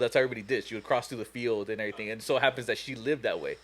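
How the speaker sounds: normal talking voice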